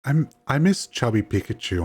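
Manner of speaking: usual speaking voice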